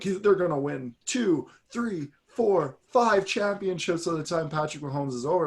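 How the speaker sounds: imitating a TV pundit's hype, mocking it. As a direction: overhype it like a TV pundit. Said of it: "Nick Wright coming out and being like".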